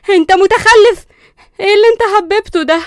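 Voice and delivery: very loud, high, fast yell; angry outburst. Acted. Linda thrown on the bed, yelling at Qusay. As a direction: lash out at him in pain.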